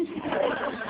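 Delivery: short loud real laugh. Real, not acted. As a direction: laugh at the memory. Laughing at drowning story.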